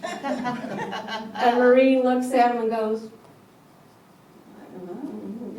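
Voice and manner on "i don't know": small, quiet, at a loss for words; much softer, unsure mock reply; timid uncertainty. Maureen is caught off guard by his question, timid and unsure. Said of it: "like she didn't know what to say".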